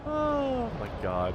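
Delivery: long astonished groan; drawn-out disbelief. Real, not acted. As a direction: let it hang in disbelief. Tornado wrecking the city.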